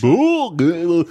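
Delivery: a hint of a dopey cartoon dog; lightly voiced bumbling cartoon dog; old Goofy voice. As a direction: goof it out like early Goofy. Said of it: "Goofy or Dippy Dog".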